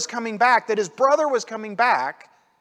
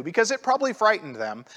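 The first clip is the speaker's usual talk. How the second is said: ordinary talk, nothing marked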